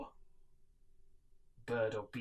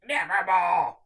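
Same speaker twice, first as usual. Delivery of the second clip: croaking nevermore; 7 semitones higher, 17 dB louder, slower than usual. Acted. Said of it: "meant in croaking".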